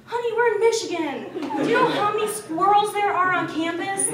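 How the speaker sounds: high, sassy honey-talk; teasing sass. Retold or imitated. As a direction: tease him like a know-it-all friend. Teasing the man about squirrels.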